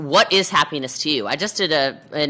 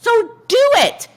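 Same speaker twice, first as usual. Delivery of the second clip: emphatic urging; 6 dB louder than usual. Real, not acted. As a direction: urge them into action, voice shooting up. Urging listeners to act.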